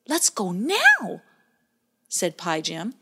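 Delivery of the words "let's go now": high, slow worried urging; anxious to beat the dark. Acted. Pie Jam urges Bowie before nightfall, slow worried voice. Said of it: "who was worried about the oncoming darkness".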